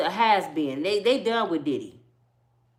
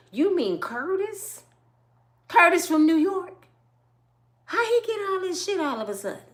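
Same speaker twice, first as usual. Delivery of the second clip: higher, put-on incredulous voice; mock surprise; 8 semitones higher than usual. Comic pretending to be shocked at Curtis's rise, incredulous. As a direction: play it wide-eyed and disbelieving.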